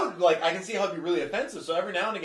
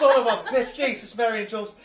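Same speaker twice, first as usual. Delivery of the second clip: put-on loud, higher Irish-accented cursing through laughter; angry Irish accent; 7 semitones higher, 8 dB louder than usual. Pissed driver swearing in the car, hammed-up Irish accent. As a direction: keep cursing in a mock Irish rage, half laughing. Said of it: "I swear at people in an Irish accent".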